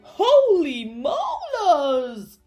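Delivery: high, loud, stretched-out shriek; drawn-out cry of alarm; shrieked shock. A narrator's reading. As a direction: shriek in comic horror. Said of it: "Doctor Dribble shrieked".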